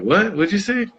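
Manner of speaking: high, startled double take; mock startled, asking him to repeat; surprised what. Inmates hearing his name, startled high what. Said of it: "And they'd look like".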